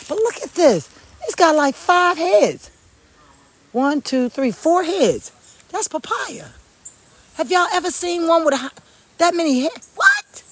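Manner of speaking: high, excited wonder rising to a shout; amazed. Real, not acted. Showing viewers a many-headed papaya, amazed. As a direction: marvel at every head you count.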